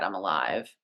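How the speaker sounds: a hushed, fragile reply; quiet. Retold or imitated. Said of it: "And she just quietly said".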